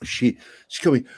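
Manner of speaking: ordinary talk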